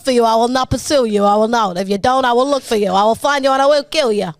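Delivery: hammed-up, run-on ultimatum; impeded gangster impression. Mobster threatening a kidnapper, hammed-up and relentless. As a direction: pile up the threats with thick consonants. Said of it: "you just have a coinciding speech impediment".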